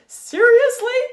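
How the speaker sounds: very high, loud bawl; panicked glider bawling at his friends. Acted. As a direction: wail it in disbelief. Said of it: "bawled Bismarck".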